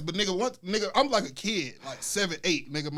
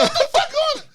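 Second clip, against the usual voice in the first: high, loud shout of disbelief; hammed-up outraged shriek; retold freak-out; 7 dB louder than usual. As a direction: yell it high, half laughing. Retold freak-out after tackle.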